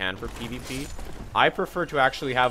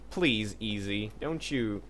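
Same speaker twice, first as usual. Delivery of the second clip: usual speaking voice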